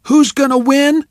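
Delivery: high, loud rally cry; cheerleader call. Acted. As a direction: pump the crowd up.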